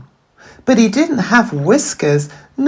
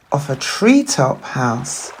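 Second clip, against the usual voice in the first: plain reading voice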